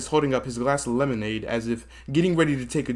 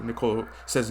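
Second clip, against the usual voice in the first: normal talking voice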